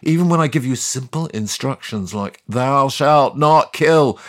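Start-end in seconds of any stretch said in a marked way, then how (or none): 2.5-4.2 slow, grand voice of God; solemn commandment. Retold or imitated. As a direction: intone it like a holy law. God quoting his own commandment.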